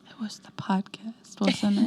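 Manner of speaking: unvoiced teasing whisper. Real, not acted. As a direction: whisper it slyly. Whispered tease.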